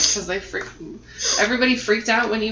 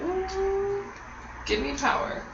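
mystical mock voice; 12 semitones higher, 5 dB quieter, much slower than usual. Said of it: "I'm like".

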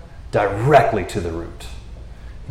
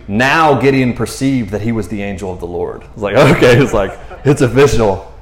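ordinary talk, nothing marked

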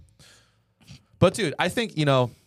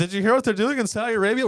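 whiny Khashoggi impression; 12 semitones higher, 3 dB louder, faster than usual. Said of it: "He'd always be like".

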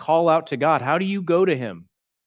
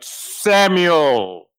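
louder, echoing divine call from afar; voice of God; 5 dB louder than usual. Acted. God calling boy Samuel in the night, louder and distant. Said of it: "That was more than I thought".

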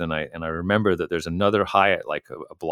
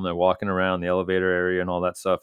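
ordinary talk, nothing marked

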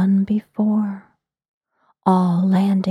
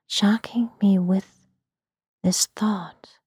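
plain reading voice

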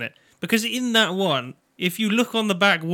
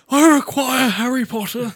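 lightly put-on villain voice, pitched up; Voldemort; 6 semitones higher than usual. Villain demanding Harry from the magic room, lightly voiced Voldemort. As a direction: declaim it as a mock villain demand. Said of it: "Lord Voldemort could have walked in".

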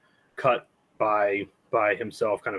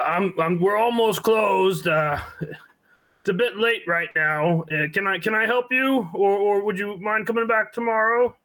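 put-on gruff old man voice; 11 semitones higher, 3 dB louder than usual. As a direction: call out as a gruff old man from the back room. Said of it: "rough, gruff man's voice kind of calls out".

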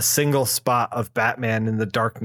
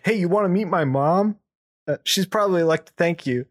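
put-on kid; 6 semitones higher than usual. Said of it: "the kid's like".

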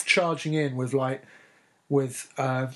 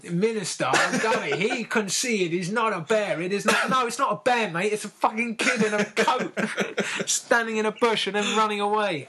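excitable believer; 6 semitones higher than usual. Retold or imitated. Mocking the Yeti fan who wants everyone to believe. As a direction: gush it like a true believer who's all over it. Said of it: "He's like".

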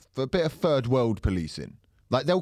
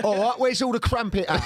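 East London; 9 semitones higher than usual. Retold or imitated. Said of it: "say it how East London".

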